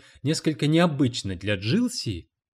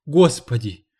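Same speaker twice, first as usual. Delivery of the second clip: a louder, exasperated "Господи!"; 7 dB louder, slower than usual. A narrator's reading. Cleaning lady despairs of the publisher, exasperated. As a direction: sigh at his stupidity. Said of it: "возвела глаза к небу".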